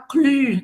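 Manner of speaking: Clu with French y, lips rounded saying i; Clu, rounded lips, tongue of i; French pronunciation. Shown as a demonstration. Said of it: "Olha a pronúncia".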